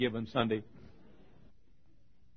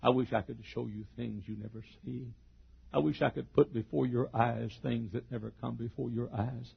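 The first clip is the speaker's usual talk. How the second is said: normal talking voice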